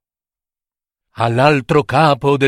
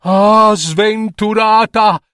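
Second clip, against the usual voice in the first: sobbing father; 6 semitones higher than usual. Acted. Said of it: "singhiozzava il padre".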